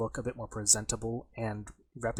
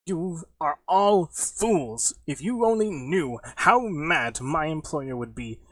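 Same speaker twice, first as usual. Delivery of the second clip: screaming; 5 semitones higher, 5 dB louder than usual. Acted. Captured Jeremy screaming threats at his captors, indignant rant. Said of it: "he starts screaming, he's like".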